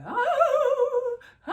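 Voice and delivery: high, hooting cartoon ghost cry; Scooby-Doo ghost wail. Comparing her scream to cartoon ghosts, high mock wail. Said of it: "those ghosts on Scooby-Doo be like".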